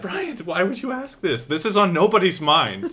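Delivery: high-pitched, incredulous outburst; exasperated disbelief. Real, not acted. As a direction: scold him in baffled protest, voice jumping up. Mock-scolding the absent host's question.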